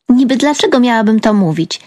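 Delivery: plain reading voice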